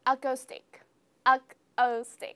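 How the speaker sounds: "acoustic" as "ak-cos-tick", then slowly broken up; naive misreading. Pretend. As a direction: sound out "acoustic" with a short o, second take drawn out. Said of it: "how would you pronounce this word?".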